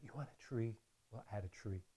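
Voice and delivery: soft, low, gentle painter murmur; a light hint of Bob Ross soothing; Bob Ross impression. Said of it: "Imagine me as Bob Ross".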